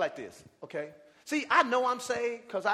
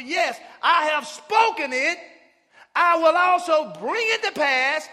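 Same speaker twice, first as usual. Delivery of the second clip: preaching scripture; 4 semitones higher, 8 dB louder than usual. Acted. Pastor reading Isaiah to his church, preaching loud. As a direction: lift the verse up to the rafters.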